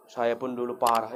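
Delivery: a parent owning up to the ustadz, softly; sheepish confession. Retold or imitated. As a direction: own up quietly, almost under the breath.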